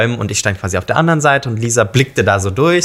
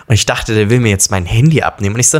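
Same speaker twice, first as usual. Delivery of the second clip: ordinary talk, nothing marked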